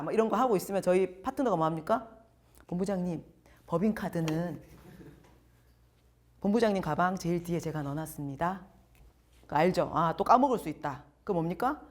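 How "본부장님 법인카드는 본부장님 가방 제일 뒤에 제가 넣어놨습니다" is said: gentle caretaking partner. Retold or imitated. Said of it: "약간 저를 약간 아기처럼".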